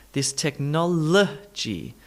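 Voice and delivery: technology slowly, tek-NOL-uh-jee; schwa demonstration. Shown as a demonstration. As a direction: draw out the reduced uh in technology. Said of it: "add in the all-important schwa".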